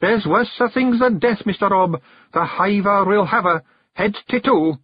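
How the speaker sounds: a lad confessing his fear for her; young Billy, earnest and scared. A narrator's reading. Billy tells Rob his fear for the hag, frightened high voice.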